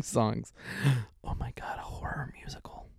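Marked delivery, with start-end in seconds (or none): 1.2-2.9 whispering. Real, not acted. Aside in amused disbelief.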